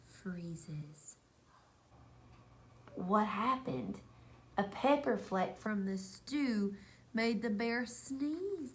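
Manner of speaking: lively storybook read-aloud with slow refrains; reading a picture book to children. A narrator's reading. Teacher reading Bear Snores On to her class, lively storybook voice. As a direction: read it like story time, drawing the kids in.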